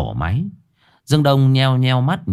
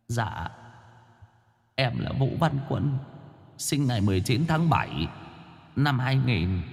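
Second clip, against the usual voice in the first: pleading ghost; 3 semitones higher, 9 dB quieter than usual. Acted. Vong Quân đáp.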